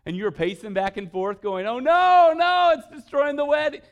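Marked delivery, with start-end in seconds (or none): 1.7-3.8 much higher, much louder hammed-up wail; mock panic. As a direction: play the frantic groom, laughing. Panicked groom waiting for pastor.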